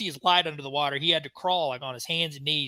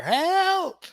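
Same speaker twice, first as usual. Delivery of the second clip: high desperate scream of a drowning man; panicked high yell for help; 7 dB louder than usual. Retold or imitated. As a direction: shriek it like you're going under. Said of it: "he was screaming bloody murder".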